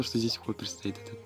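usual speaking voice

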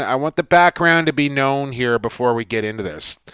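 ordinary delivery

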